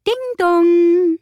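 high, loud sung ding dong; doorbell chime. A narrator's reading. The doorbell rings at the door, high and loud. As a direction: ring out like a doorbell.

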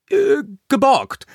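sheepish, high, hesitant excuse; caught-out, peeved mumbled-excuse feel; sullen. A narrator's reading. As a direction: offer a grudging excuse after hesitating. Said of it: "sagte Finn verdrossen".

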